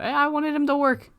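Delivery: mock falsetto. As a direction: squeak the line as a joke. Repeats the joke line.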